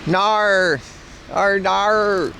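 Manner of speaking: exaggerated Aussie "oh no"; Australian accent. Streamer teases Australian viewers, exaggerated Australian accent. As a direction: stretch the vowels in a mock Australian accent. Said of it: "Not all of us do that".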